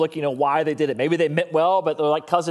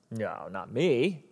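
mock denial; 8 semitones lower, 7 dB quieter, much slower than usual. Imagined reader's denial.

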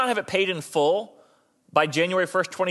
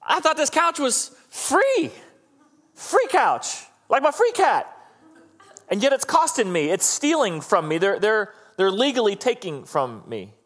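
whiny cheated buyer; 4 semitones higher than usual. Retold or imitated. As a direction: whine like a duped shopper. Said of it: "And you're like".

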